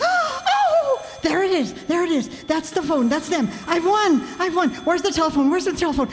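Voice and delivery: breathless, high excited babble. Acted. Wife hears the contest call ring, frantic excitement. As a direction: rush about thrilled by the ringing phone.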